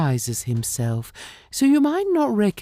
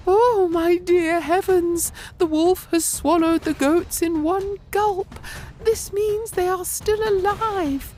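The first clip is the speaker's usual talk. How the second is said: shocked Mother Goat. A narrator's reading. Mother Goat sees movement in the wolf's belly, high and amazed. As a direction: gasp in shock, then realize they live.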